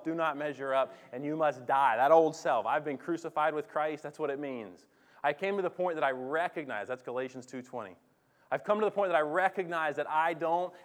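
loud, driving preacher voice; full-force preaching. Acted. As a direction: preach it hard to the church.

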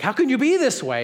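mock amazed Wesley; astonished question. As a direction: ask it amazed, voice jumping up. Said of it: "And he's like".

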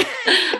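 a burst of high laughter at her own quip. Real, not acted. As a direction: laugh out, amused. Host laughs at own joke.

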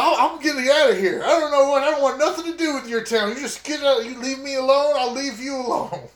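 high, fast and rattled; frantic, wanting out; agitated. Acted. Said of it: "I was like".

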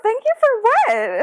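baffled surprise. Retold or imitated. Teen girl thanked by a shy classmate, incredulous high surprise. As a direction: act out total bafflement.

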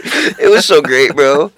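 normal talking voice

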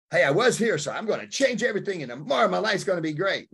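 high, upbeat imitation of naive resolve; mock eager optimism. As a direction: imitate someone gushing about a fresh start. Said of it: "when I hear someone speaking like that".

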